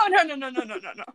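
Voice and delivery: flustered, laughing string of refusals; laughing protest. Real, not acted. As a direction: laugh and rattle off denials. Laughing denial of the accent attempt.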